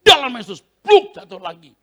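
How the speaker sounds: loud, high strike in Jesus' name; preacher casting out with a loud shout; loud rebuke. Retold or imitated. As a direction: slam the words down.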